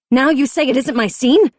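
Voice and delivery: high loud blaming of the chief; furious accusation. A narrator's reading. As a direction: hurl it at him.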